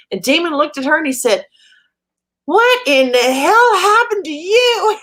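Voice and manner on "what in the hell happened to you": higher, louder, slow shocked outburst; shocked exclamation. Retold or imitated. Friend Damon shocked at mom's bruises, loud slow disbelief. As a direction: gasp it out at her bruised face.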